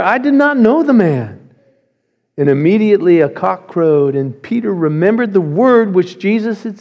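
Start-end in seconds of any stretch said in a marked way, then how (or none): 0.0-1.4 louder and higher, swearing it; cursing denial. Acted. Peter curses and swears to the crowd, loud and desperate. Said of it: "began to curse and swear".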